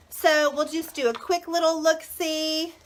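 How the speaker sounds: ordinary talk, nothing marked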